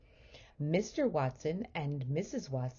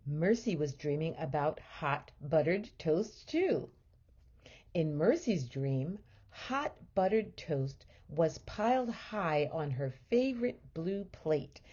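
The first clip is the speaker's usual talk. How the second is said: usual speaking voice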